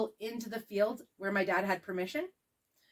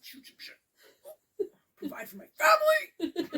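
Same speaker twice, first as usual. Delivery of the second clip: hammed-up, high strained parody voice; mock macho man; slower than usual. Podcast host spoofing masculinity and hunting, strained mock-macho voice. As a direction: squeeze it out as a joke, high and laughing.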